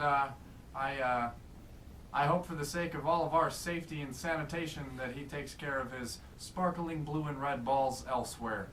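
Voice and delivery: ordinary delivery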